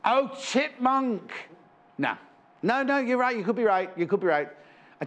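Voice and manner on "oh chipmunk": high and very slow, drawn out; light up as the answer hits; oh, it clicks: chipmunk, stretched high; excited realisation. Real, not acted. Sudden idea of the animal.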